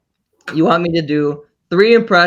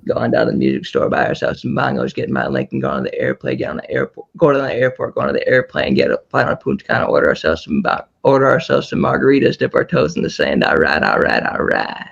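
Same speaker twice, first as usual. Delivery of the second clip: Matthew McConaughey voice; 12 semitones lower, 3 dB quieter than usual. Retold or imitated. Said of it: "Matthew McConaughey".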